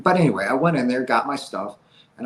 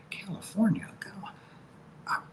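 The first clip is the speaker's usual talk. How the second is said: stunned whisper; 7 semitones higher, 9 dB quieter, much slower than usual. Retold or imitated. Reacting to huge offer.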